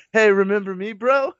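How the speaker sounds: high, breezy dude voice; overly casual bro; mock buddy greeting. Imagined greeting to old instructor.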